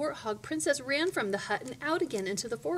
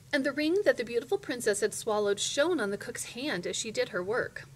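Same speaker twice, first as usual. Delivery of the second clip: usual speaking voice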